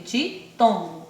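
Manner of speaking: ordinary delivery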